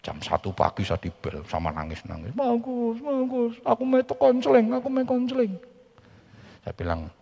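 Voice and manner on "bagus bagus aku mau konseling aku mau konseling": high pleading voice, a touch of tears; crying woman's voice. Retold or imitated. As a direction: beg for counseling, pitched high. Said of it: "crying woman on phone at night".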